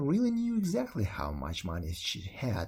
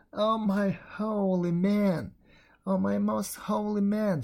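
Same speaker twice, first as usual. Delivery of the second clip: higher, slow pleading wail; crying between sobs; 7 semitones higher, 3 dB louder, slower than usual. A narrator's reading. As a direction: beg through tears, voice raised. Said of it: "she cried between her sobs".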